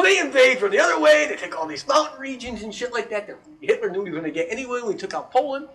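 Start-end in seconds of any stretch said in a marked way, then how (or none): none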